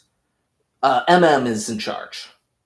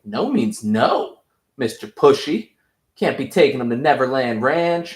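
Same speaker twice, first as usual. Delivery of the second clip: mocking taunt; 4 semitones higher, 5 dB louder than usual. Homelander mocks Butcher over Ryan, smug taunting. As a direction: taunt him like a smug bully.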